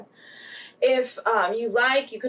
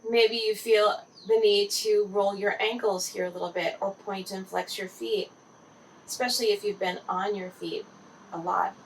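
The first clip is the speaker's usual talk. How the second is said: guided meditation. Acted. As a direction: gently guide the listener into relaxed body awareness.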